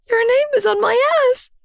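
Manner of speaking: high, fast panicked shout; horrified shout. Acted. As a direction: blurt it out in horror. Horrified outburst at mirror.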